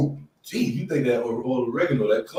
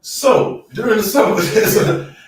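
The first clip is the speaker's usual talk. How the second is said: laughing; 10 semitones higher, 8 dB louder, much slower than usual. Real, not acted. Laughing after testicles remark.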